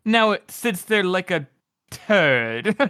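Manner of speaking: slow, pause hanging before "turd."; imitating the narrator's reluctant pause before "turd."; dramatic pause before turd. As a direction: hold a beat, then drop "turd.". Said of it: "pauses whenever he says that".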